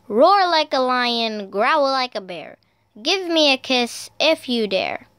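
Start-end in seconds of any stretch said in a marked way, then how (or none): none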